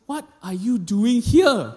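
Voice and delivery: a lightly voiced teacher, puzzled; teacher questioning her former student, slow and puzzled. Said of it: "she looked at me and said".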